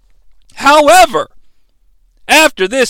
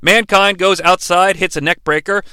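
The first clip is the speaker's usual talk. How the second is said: normal talking voice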